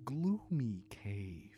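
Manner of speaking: soft and hushed. A narrator's reading. Entering the gloomy cave.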